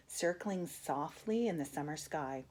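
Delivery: ordinary delivery, nothing marked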